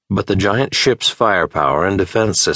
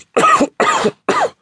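rising hacking cough; choking cough. A narrator's reading. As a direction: cough on toxic gas. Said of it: "in between coughs".

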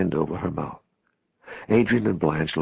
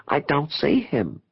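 high, frustrated protest; slow, high cry of bewilderment; 9 semitones higher, slower than usual. A narrator's reading. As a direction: wail it mildly, left out. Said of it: "Adrian cried".

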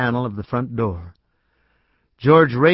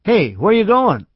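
calling out; 9 semitones higher, 4 dB louder than usual. A narrator's reading. Calling after fleeing man.

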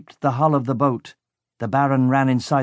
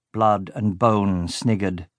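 ordinary delivery

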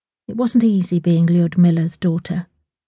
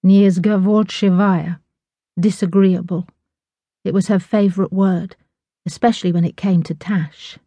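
usual speaking voice

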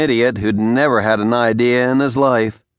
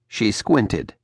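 usual speaking voice

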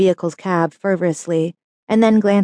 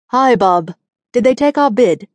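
put-on South African accent; 4 semitones higher, 4 dB louder than usual. A narrator's reading. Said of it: "He speaks with a South African foreign accent".

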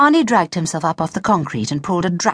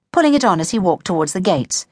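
ordinary delivery

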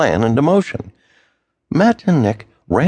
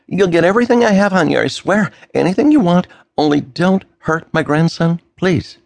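desperate pleading; 8 semitones higher than usual. Acted. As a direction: rush out the promise in panic. Grandfather begging the kidnapper.

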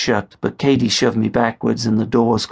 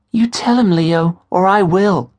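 put-on urging girlfriend; 9 semitones higher, 3 dB louder than usual. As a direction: push him to come clean. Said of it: "she urged".

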